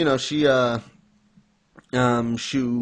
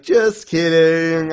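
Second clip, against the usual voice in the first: loud, higher, drawn-out mock reveal; teasing; 5 semitones higher, 7 dB louder, much slower than usual. Grandpa reveals his trick to Ruri, teasing. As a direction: stretch it out like a gleeful prankster.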